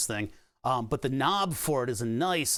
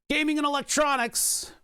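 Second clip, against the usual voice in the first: excited outburst; 12 semitones higher than usual. Real, not acted. Mock-excited exclamation.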